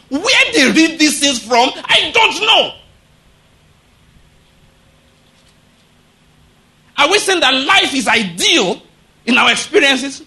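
high, loud, fervent preaching; full-force preaching. Acted. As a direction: preach it hard to the congregation.

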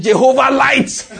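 high, drawn-out shout cracking into laughter; imitating a brother's fervent prayer shout; excited shout. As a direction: shout it out long and high like an overexcited believer. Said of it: "The brother shouted".

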